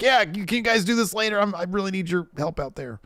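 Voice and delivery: high, hurried mock plea; imitated pleading student. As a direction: imitate a flustered wrestler begging for help. Acting Thea pleading with both.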